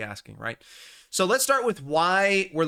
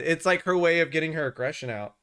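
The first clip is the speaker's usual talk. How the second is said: ordinary talk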